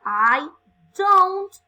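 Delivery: "I don't" loud, stretched, "don't" high and punched; careful pronunciation. Shown as a demonstration. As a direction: hammer out "don't" like a teacher drilling it. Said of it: "cuidado con la pronunciación".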